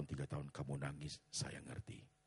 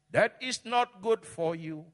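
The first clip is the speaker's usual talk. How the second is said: much higher and louder, slow; slow shouted warning; 15 dB louder, slower than usual. Real, not acted. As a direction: stretch and shout every word. Pastor warns forcefully. (peringatan keras dan pelan)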